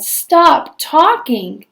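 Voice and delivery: slow, drawn-out stop talking; weary exasperation. A narrator's reading. Stepmother, fed up, drawn-out plea to Cindy. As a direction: stretch each word out, fed up. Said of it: "I said".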